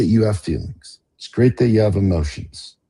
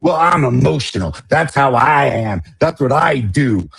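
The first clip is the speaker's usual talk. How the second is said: mock whiny excuse; 5 semitones higher than usual. Mocking a teacher's excuse.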